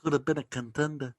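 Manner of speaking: a hint of Brando mumble; quoting Brando from On the Waterfront; Marlon Brando. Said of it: "his role model was Marlon Brando".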